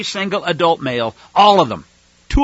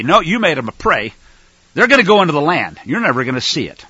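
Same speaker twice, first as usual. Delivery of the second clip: usual speaking voice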